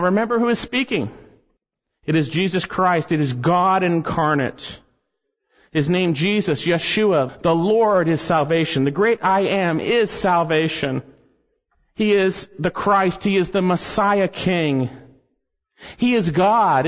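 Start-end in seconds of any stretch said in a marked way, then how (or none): none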